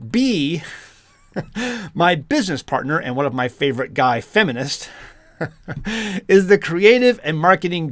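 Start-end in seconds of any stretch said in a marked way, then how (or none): 1.3-1.9 quick chuckle at the text; brief real laugh, kept soft; amused little laugh; short laugh. Real, not acted. Laughs while reading the article. 5.4-6.2 soft chuckle mid-sentence; brief real laugh; short laugh. Real, not acted. Laughs while reading the article.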